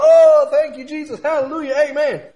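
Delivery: high, loud mock worship cries; put-on hallelujah shouting; imitated praise shout. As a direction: shout praise like a fervent congregant. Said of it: "they'll shout and praise God".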